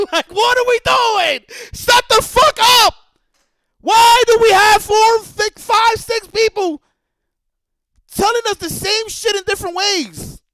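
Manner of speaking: high, loud, slowed-down exasperated shouting; furious yell. Real, not acted. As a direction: blow up at the company. Ranting at the company.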